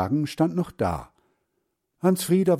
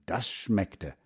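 ordinary delivery

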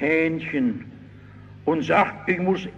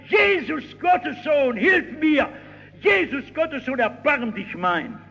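much higher, pleading cry; desperate crying out; 8 semitones higher than usual. Retold or imitated. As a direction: cry out for help, pitched far up. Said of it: "da fängt er an zu schreien".

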